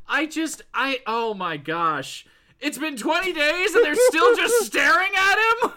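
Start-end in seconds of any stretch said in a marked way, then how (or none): 2.6-5.7 high, loud disbelieving cry; incredulous shout. Real, not acted. Disbelieving outburst about doctors.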